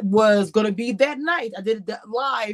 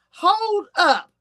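retold confusion; 8 semitones higher than usual. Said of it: "I was saying".

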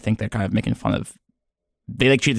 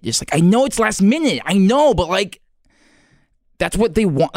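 agitated; 9 semitones higher, 3 dB louder than usual. Real, not acted. Pleading agitated outburst.

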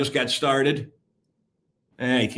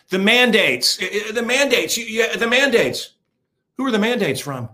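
a hint of a stammering, higher whine; mocking whiny voice; 7 semitones higher than usual. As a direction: mimic a flustered man, pitched up. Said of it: "the lisping Soy Boy".

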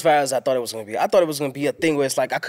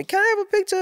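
much higher, squeaky fan voice asking for a picture; mock eager fan; 4 dB louder, faster than usual. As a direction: play a pushy fan, pitched way up. Imitating fan asking for photo.

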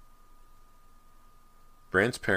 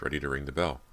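plain reading voice

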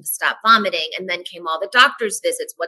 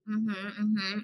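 much softer, noncommittal murmur; soft mock mm-hmm; 8 dB quieter than usual. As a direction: murmur it like a doctor taking notes. Doctor noting things down.

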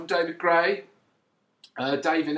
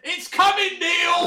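higher, louder mock heckle; mock shout; 13 semitones higher, 5 dB louder, slower than usual. Fan in the North Bank heckles the keeper in a mock shout. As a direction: jeer at the keeper from the stands. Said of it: "kept shouting".

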